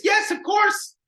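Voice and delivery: high, loud overeager yes; faked eager agreement. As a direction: gush a phony, instant agreement. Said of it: "I'm just faking it".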